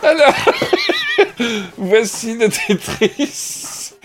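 words squeezed out through laughter; slow, laughing through the line. Real, not acted.

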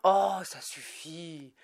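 slow, loud fed-up cutoff; irritated interruption. Acted. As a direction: cut him off, fed up. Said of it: "l'interrompit quatre cent soixante-dix".

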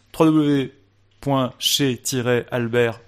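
normal talking voice

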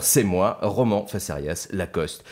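ordinary delivery

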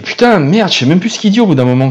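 fed up and fast; exasperated. Real, not acted. Exasperated rant about the tic.

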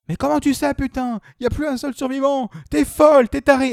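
high, loud outraged disbelief; incredulous yelling. Retold or imitated. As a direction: yell it like she's insane. Character yelling disbelief in film.

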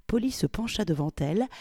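usual speaking voice

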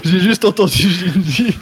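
laughing, high and giggly; nervous laugh. Real, not acted. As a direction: giggle through the words. Laughing after misheard name.

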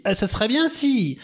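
mimicking volunteer. Quoting a volunteer.

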